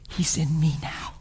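whispered, tender reassurance; hushed whisper. Retold or imitated. Father comforting his daughter about his faith, hushed whisper. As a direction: drop to a soft, intimate whisper.

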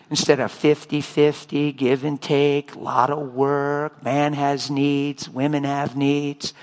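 slow, low, droning list; drawn-out, dreary mock recital; weary recital. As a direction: plod through it like a tiresome formula. Mocks the usual marriage mindset.

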